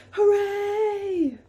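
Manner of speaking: high, loud happy cheer; cheering crowd. Acted. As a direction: shout hooray like a crowd. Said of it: "the people cried".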